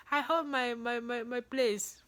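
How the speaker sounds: ordinary delivery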